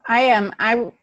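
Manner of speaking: ordinary delivery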